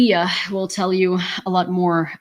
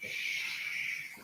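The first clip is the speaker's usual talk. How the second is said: soft unvoiced hush to calm a pet; soothing shush; 17 dB quieter than usual. Real, not acted. Owner hushes her trembling dog, soft breathy shush.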